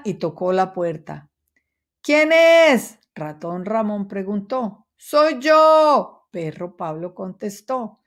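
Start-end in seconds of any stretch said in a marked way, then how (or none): 2.0-3.0 small high squeaky mouse asking at the door; high, loud little mouse voice. A narrator's reading. Mouse asking who is knocking, high and loud. 5.0-6.3 dog voice. A narrator's reading. Dog answers at door.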